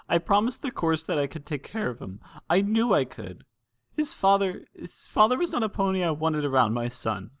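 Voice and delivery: high, trembling voice of a frightened mare; a worried mother holding back tears; tearful mother. Acted. Frightened mother telling the princesses of her missing son, high and tearful. Mother explains about father.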